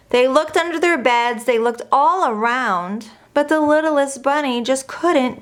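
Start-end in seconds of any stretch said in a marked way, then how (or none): none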